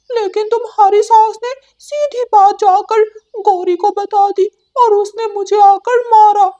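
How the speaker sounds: high, bright cartoon parrot voice; talking parrot; chatty parrot. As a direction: squawk it out like a cheeky parrot bird. Parrot explains to Ranu.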